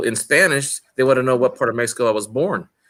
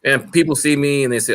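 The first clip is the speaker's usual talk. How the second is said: ordinary talk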